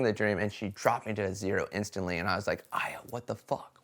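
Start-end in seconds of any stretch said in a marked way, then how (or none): 2.7-3.8 soft, stunned question at Aya; stunned disbelief. Retold or imitated. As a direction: let it fall out quietly in shock. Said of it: "the more I was getting mad at her".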